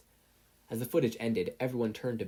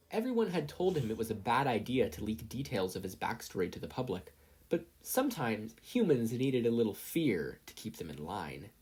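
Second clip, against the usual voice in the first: usual speaking voice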